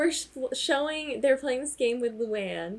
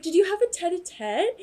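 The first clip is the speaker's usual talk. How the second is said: high-pitched prying question; Andy; 9 semitones higher, 4 dB louder than usual. Retold or imitated. Retelling the host quizzing Luann, high mock questioning. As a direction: imitate a nosy host, pitched up. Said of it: "And Andy's like".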